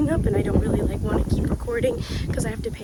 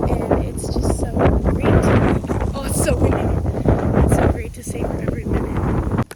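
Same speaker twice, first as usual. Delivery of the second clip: over the wind; 8 dB louder than usual. Real, not acted. Talking over strong wind on summit.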